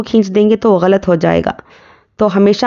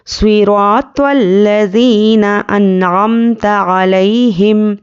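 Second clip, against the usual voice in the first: slow, measured recital with long held vowels; Quran recitation; much slower than usual. Shown as a demonstration. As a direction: recite as a model, stretching each long vowel. Teacher recites the verse as model.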